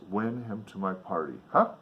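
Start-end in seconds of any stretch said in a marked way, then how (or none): none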